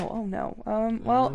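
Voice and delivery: ordinary talk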